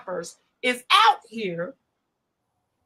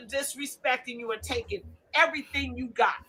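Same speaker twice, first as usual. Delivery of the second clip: ordinary talk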